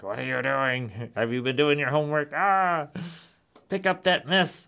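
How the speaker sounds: mock-nag like a fussy grandmother; nagging grandma. Ghost grandparents nagging the grown grandkid, a mock scold. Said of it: "They're like".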